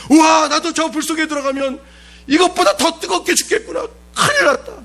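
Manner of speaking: a hammed-up soldier panicking before the furnace; mock terror. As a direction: gasp it like a man who sees his death. Imagined terrified soldier.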